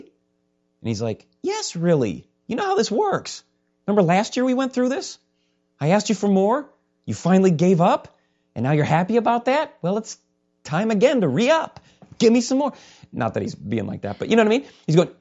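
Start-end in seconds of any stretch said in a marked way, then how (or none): 1.4-12.7 high, playful coaxing demand; insistent God. Acted. God reminding a believer of last year, playfully insistent. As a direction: act a pushy God, pitch lifted.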